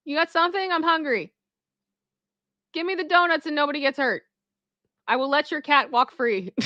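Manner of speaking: lightly voiced bear shaking them down; casual bear voice. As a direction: play a hungry bear bargaining. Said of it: "that bear is like".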